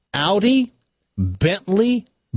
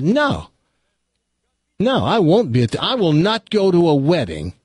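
acted father's higher-pitched refusal; mildly hammed-up dad putting his foot down; firm refusal; 5 semitones higher than usual. Father refuses wedding in joke.

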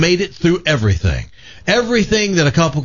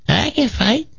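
high, put-on old lady voice, defiant; an eighty-eight-year-old demanding her place; feisty old granny; 9 semitones higher than usual. Granny telling the Hells Angels she wants in, in a high put-on old voice. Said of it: "teeth missing".

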